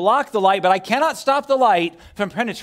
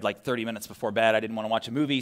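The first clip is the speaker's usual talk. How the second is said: ordinary talk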